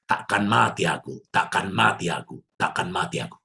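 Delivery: hushed, fading declaration; resolved vow. Retold or imitated. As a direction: vow quietly to yourself. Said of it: "Lalu aku mengatakan kalimat ini".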